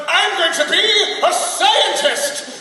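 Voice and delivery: over-eager student proclamation; mock-proud announcement. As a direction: declare it grandly, stretching the last word. Acting out his eager college self.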